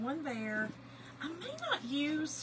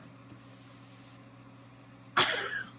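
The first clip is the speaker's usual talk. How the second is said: long voiceless wheezing laugh; laughter. Real, not acted. Cracking up at her cousin's hollering, laughing. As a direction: double up laughing, almost silent. Breaks up while telling story.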